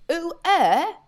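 a Welsh dad asking the way in very bad French, slow and loud; Welsh farmer's French. Welsh dad asks a Paris local for the Eiffel Tower, slow, loud Welsh-accented French. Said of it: "you know, Welsh farmer".

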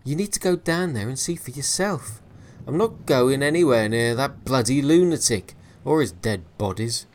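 loud, high, heated sailor; angry refusal. Acted. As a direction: throw the idea back in his face. Williams refuses angrily.

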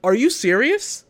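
high-pitched mock disbelief; incredulous quote. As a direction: imitate a player who can't believe it. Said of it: "He's like".

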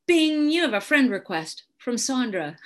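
playful app-notification voice, drawn out; mock notification announcement. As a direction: ring it out like a phone alert.

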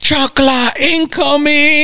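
high, slow, mock-threatening call; high drawn-out playful shout; mock mom warning. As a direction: warn of the flying sandal like an angry mom. Imitating mom with a sandal.

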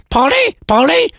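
very high parrot squawk. Acted. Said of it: "So a law-breaking parrot there".

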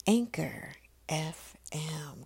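slow, whispered station name. Shown as a demonstration. Host naming the station, in a slow drawn-out whisper. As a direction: draw out Anchor F M in a slow whisper. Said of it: "Come on".

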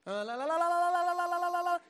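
loud, high, rapid la-la-la; drowning-out la-la. Acted. Sinner refusing to hear God, loud mocking la-la-la. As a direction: babble la-la loudly to block someone out.

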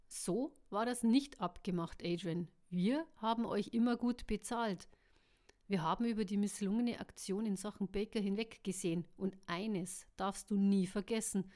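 ordinary delivery, nothing marked

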